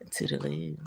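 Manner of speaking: breathy whispered farewell; a soft whispered toodaloo; whispered goodbye. Real, not acted. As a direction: whisper the sign-off. Whispered sign-off to viewers.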